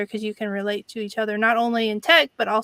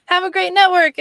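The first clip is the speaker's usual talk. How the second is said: mock advice voice; 8 semitones higher, 8 dB louder than usual. Mocking generic networking advice.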